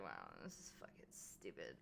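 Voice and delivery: whisper. Retold or imitated. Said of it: "I was like".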